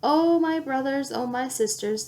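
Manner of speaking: ordinary delivery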